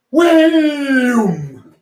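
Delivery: high loud shout of wham. Shown as a demonstration. Teacher surprising the class with a loud high shout. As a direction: shout it like a crash. Loud hit in rhythm game.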